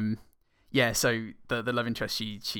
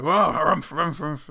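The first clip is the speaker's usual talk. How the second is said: louder, higher pompous harrumphing; court spluttering; 5 semitones higher, 8 dB louder than usual. Retold or imitated. Everyone in court reacting, spluttering harrumphs. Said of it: "everyone will just go".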